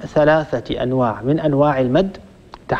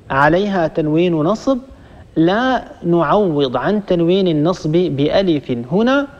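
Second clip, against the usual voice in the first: ordinary talk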